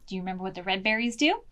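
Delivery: usual speaking voice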